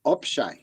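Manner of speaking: "Opshe" said as op-shay, falling; op-shay, a falling demo word; pig latin word. Shown as a demonstration. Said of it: "how would I say shops".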